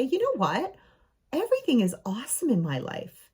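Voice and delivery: high, swooping show of positivity; acted cheerful defiance; upbeat declaration. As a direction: proclaim it brightly, pitch leaping high. Models turning tables on gloomy friends.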